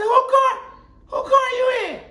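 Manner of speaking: very high mock mom voice shouting; angry mom. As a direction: play the suspicious mother from the doorway. Mother voiced in story.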